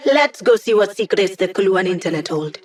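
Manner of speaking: usual speaking voice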